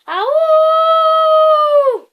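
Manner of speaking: high, loud, drawn-out wounded howl; howl like a wounded dog. Shown as a demonstration. Reader howls as Hagrid, a wounded-dog howl. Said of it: "do my best howl right now".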